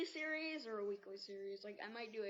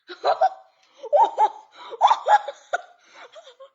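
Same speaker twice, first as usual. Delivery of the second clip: a big squealing laugh, much louder and higher; loud high burst of laughter; 23 dB louder than usual. Real, not acted. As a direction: crack up at the pop. Laughs at Pop in story.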